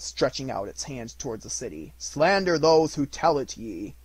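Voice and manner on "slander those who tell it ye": loud, rising, bitter spirit; scornful ghost. Acted. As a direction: fling the words at him.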